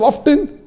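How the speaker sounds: OFTEN with a sounded T; pronounced T. Shown as a demonstration. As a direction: say often as "off-ten". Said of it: "T is not silent".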